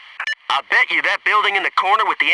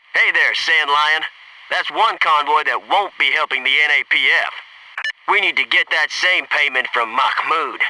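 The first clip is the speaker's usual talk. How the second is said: ordinary talk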